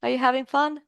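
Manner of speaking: ordinary talk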